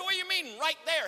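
high, incredulous near-shout; loud disbelief. Retold or imitated. Customer baffled by the cashier's answer, loudly incredulous. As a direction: throw the question back in disbelief.

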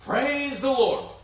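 high, slow, drawn-out Praise the Lord; joyful praise. Retold or imitated. Old lady overjoyed at the groceries, high and slow. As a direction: let it soar slowly in joy. Tearful joy over groceries.

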